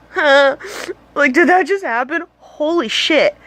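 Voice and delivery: stunned disbelief. Retold or imitated. Stunned after singer noticed her.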